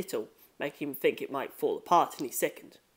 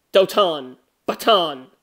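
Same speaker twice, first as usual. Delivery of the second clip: two sharp, loud thump words; loud thuds; 7 dB louder, slower than usual. A narrator's reading. Cat's memory of a body hitting the floor, loud thuds. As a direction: punch each thud out like a crash. Sound of someone falling, written.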